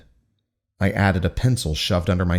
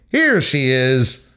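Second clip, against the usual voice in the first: cheery announcement; 4 semitones higher, 3 dB louder, much slower than usual. Acted. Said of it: "by saying".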